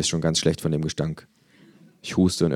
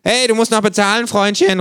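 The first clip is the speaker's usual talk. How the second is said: much higher, louder call; calling after; 9 dB louder than usual. Acted. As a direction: shout after him down the hall. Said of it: "ruft mir das Känguru hinterher".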